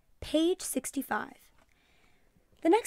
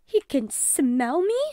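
gasping; 3 semitones higher, 7 dB louder, much slower than usual. A narrator's reading. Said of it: "Missus Peroni gasped".

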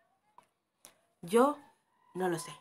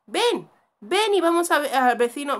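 loud, raised-pitch summons; calling out; 4 semitones higher, 7 dB louder than usual. Real, not acted. Player calls companion to the neighbour's, loud call. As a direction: call them over insistently.

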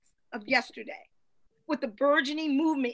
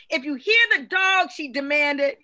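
preacher calling, crowd answering keep going; call and response; 9 dB louder than usual. Real, not acted. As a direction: rouse the congregation to answer.

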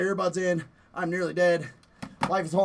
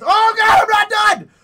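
high, loud frantic shout; desperate yell; 12 semitones higher, 11 dB louder than usual. Real, not acted. Player pleading with the game, frantic shout. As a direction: cry out like you're nearly dead.

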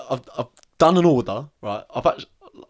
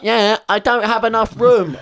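mocking the woman; 9 semitones higher, 6 dB louder, slower than usual. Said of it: "She's like".

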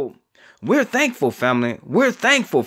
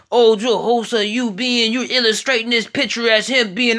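mock protester; 4 semitones higher than usual. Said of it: "Can't say".

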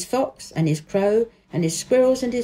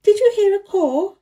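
put-on high, louder, excited little girl; eager girl; 12 semitones higher, 6 dB louder than usual. A narrator's reading. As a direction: lift it up with childish excitement. Mary asks, excited girl voice.

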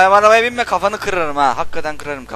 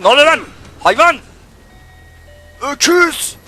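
loud, high furious shouts at the screen; angry outburst of insults; angry yelling; 11 semitones higher, 6 dB louder than usual. Real, not acted. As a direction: yell insults at the game character. Yelling at a game character.

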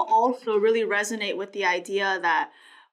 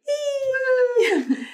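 excited squeal. Real, not acted. Playful cheer after introducing co-host.

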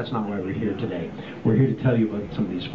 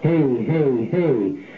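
fuzz distortion; 3 semitones higher, much slower than usual. Shown as a demonstration. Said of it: "you can hear that fuzz happening".

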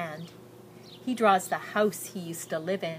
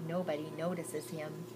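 ordinary delivery

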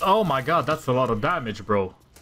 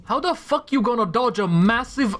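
high, loud squeak of disbelief; outraged at the miss; exasperated disbelief; 8 semitones higher, 4 dB louder than usual. Real, not acted. Another dodge, frustration.

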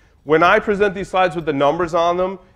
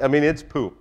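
ordinary delivery

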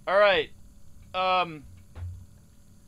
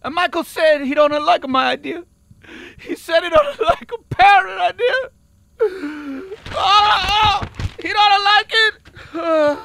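hammed-up crying; 9 semitones higher, 10 dB louder than usual. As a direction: voice breaking into mock sobs, much higher and louder. Crying after idea rejected.

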